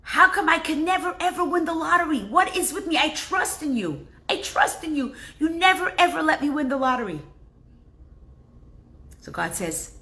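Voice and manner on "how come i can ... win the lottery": a man crying out to God about the lottery; high-pitched aggrieved plea; complaining to God. Retold or imitated. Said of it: "cries out to God".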